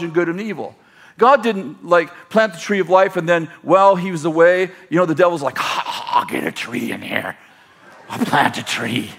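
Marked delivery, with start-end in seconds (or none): 5.6-9.1 deep, quiet mock devil; low sinister devil rendition. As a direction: growl it low like a cartoon devil. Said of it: "My rendition of the devil".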